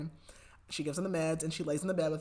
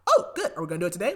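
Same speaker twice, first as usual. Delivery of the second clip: eager; 8 dB louder, much faster than usual. Retold or imitated. Son eager about planned suicide.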